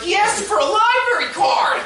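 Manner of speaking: high, loud, drawn-out disbelief; exasperated retort at full pitch; incredulous shout. Retold or imitated. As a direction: yell it like it's utterly absurd. Acted incredulous retort to the brother.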